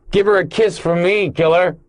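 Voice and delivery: high, loud jeer called after someone; taunting yell. A narrator's reading. Brockman yells after Bosch as he leaves, taunting shout. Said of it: "Brockman yelled after them".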